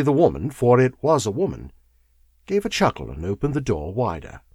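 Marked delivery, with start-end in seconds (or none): none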